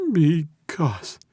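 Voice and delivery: high, halting, fearful start; frightened captive. A narrator's reading. Captive begins confessing to mob boss, frightened. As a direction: falter in fear, pitched high.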